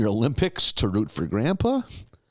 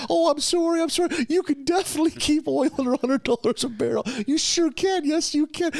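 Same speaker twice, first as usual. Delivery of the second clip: much higher, fast, mock-sweet apology; sarcastic apology; faster than usual. Homer apologizes to the baby, sugary and sarcastic. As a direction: coo it like soothing an offended baby. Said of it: "He's like".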